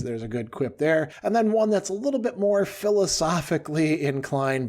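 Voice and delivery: ordinary talk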